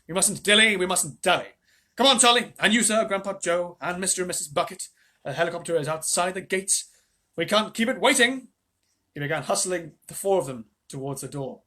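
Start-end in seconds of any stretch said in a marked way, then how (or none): 0.0-8.5 high, brisk, bustling Wonka; Mister Wonka voice. Acted. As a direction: hurry them along. Wonka hurrying everyone.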